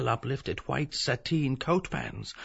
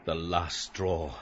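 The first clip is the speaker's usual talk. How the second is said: cold; 6 semitones higher, 10 dB louder than usual. Acted. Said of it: "Bloom, coldly".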